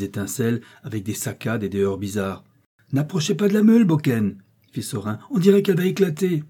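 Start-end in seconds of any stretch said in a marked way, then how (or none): 2.9-4.4 high, loud cry of warning; alarmed warning. A narrator's reading. Worker shouts a warning at danger. 5.3-6.4 high, loud and anxious; alarmed warning. A narrator's reading. Worker fears millstone will burst.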